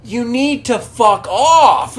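high, drawn-out retold telling-off; mock angry outburst. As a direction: stretch it out like an exasperated rant. Continues the acted outburst.

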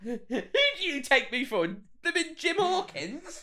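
high-pitched, hammed-up affront, stretched at the end; mock indignation. Podcaster imagining the character refusing the comparison, mock indignation. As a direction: squeal it as comic outrage. Voices Carla's indignant retort, laughing.

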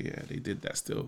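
breathy half-whisper, barely voiced; whispered aside. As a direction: drop to a whisper. Grudgingly giving rivals credit.

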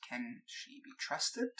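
slow, soft, confidential question; hushed aside. Acted. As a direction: ask discreetly, pulled aside. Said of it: "she kinda pulls you aside".

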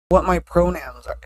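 ordinary talk, nothing marked

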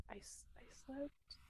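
embarrassed whisper, barely voiced; ashamed little whisper; sheepish whisper. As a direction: confess it in a tiny whisper. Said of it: "I'm like".

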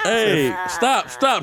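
imitating his panicked protest; mock alarmed. Retold alarm, voiced.

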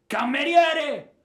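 desperate loud cry for water; shouting for help. Acted. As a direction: yell across the house for the servant. He calls out for the servant.

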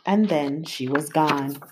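usual speaking voice

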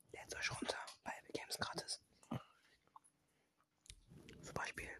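hushed mock-ad whisper. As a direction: whisper it like a secret tip.

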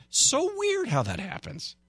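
mock-amazed high sarcastic voice. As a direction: play it fake surprised. Sarcastic aside on crime drop.

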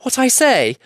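high, loud frightened gasp; squeaky, panicked little voice; gasping hobbit. A narrator's reading. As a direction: gasp it out in fear.

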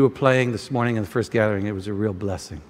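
low, soft, heartfelt; tender praise. Real, not acted. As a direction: thank the musician gently.

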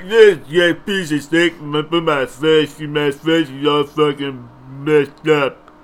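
put-on slow, slurred punch-drunk boxer; Rocky. Mimicking Rocky with a messed-up face, slurred impression. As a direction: slur it as if the face is numb. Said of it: "That's how he talks before he got into boxing".

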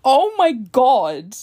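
drawn-out loud Oh my God; slow loud exclamation; loud slow disbelief. Real, not acted. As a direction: stretch it out in shocked delight.

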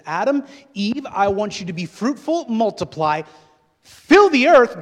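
loud, high preacher voicing God's command; booming divine command. God commanding Adam and Eve to multiply, booming command.

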